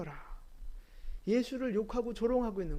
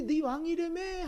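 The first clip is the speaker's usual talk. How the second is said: high, drawn-out imitated jeer; teasing taunt; 8 semitones higher, slower than usual. Crowd teasing Jesus on the cross, mocking. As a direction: stretch it into a mocking singsong. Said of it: "하고 놀리고 있지만".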